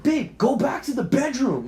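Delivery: high, urgent order to partner; acted agitated command; furious. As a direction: order them back, angry and rattled. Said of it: "I'm fucking furious and I'm shaking".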